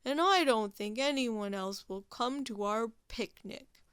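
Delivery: grumbling Walrus. Acted. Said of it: "grumbled Walrus".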